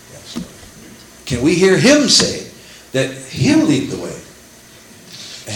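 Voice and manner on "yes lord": breathy whisper of surrender; hushed yes Lord; whispered reply. Retold or imitated. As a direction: whisper it in devotion.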